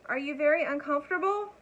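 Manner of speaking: high, loud call over the storm; screaming over noise. A narrator's reading. Elmer shouting his question over the storm, high and loud. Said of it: "he screamed above the noise".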